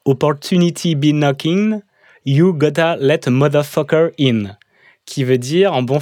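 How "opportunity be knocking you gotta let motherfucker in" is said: French speaker's attempt at an English accent, slow and emphatic at the end; English lyric read out in a French-tinged English accent; put-on English accent. Shown as a demonstration. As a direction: announce it in your best English accent. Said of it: "Attention, accent anglais".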